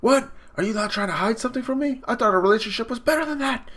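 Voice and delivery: high, fast indignant screech; acted outraged woman's outburst; screaming at him. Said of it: "she's screaming at him".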